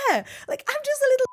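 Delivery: very high, loud, breezy certainty; full confidence. Retold or imitated. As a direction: sell it as if nothing's wrong. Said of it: "I repeated with the full confidence".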